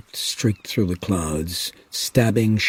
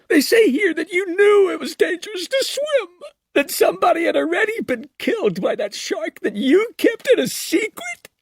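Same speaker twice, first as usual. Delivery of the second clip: accusing scream. Acted. Kintner's mother accusing Brody.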